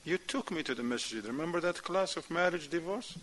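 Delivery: soft, restrained voice of a patient wife; lightly acted, understated wifely reminder; wife calmly explaining. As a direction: reason with him quietly. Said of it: "She will tell him".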